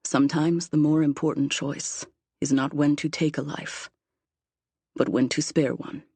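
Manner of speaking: low, measured fatherly counsel; remembered father's voice. A narrator's reading. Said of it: "My father's words ring in my ears".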